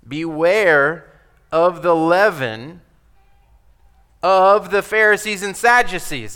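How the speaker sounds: Jesus warning his disciples; stern warning. Acted. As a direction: warn them firmly. Reading the command.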